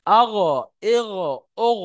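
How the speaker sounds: আগ, ইগ, ওগ each ending in a throaty gh; ghayn with three vowels. Shown as a demonstration. Teacher demonstrates ghayn for students, demonstrating. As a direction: drill আগ, ইগ, ওগ from the throat, one by one. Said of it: "এই শেষ ভাগে".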